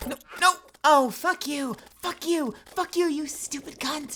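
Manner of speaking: high furious screaming at the boss; raging gamer. Real, not acted. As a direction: lose it completely and yell. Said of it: "supposed to be a chill stream".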